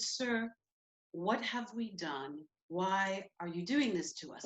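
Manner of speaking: soft, careful, deferential appeal; very polite. Retold or imitated. Student pleading with an officer, soft and polite. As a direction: ask it softly and respectfully. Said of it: "be as polite as possible".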